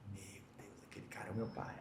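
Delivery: breathless whisper of disbelief; stunned whisper. Retold or imitated. Son whispers on spotting his lost father, stunned whisper. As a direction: whisper it as if you can't believe your eyes.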